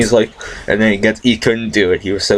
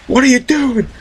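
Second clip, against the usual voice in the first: squeaky put-on voice; high mocking voice. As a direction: voice him high and silly. Celebrity voiced.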